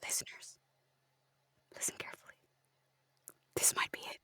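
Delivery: whispered aside to the audience; unvoiced conspiratorial whisper.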